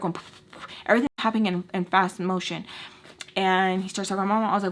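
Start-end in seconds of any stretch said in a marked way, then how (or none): none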